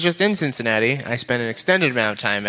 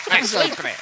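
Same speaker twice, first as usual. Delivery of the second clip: Kyle the Possum; 7 semitones higher than usual. Acted. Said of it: "Kyle the Possum".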